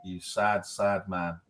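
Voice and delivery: low, quiet, pitying put-down; imitated deadpan scorn. Wife looking at smiling husband, imitated low deadpan pity. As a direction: mutter it with mock disdain. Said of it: "and she goes".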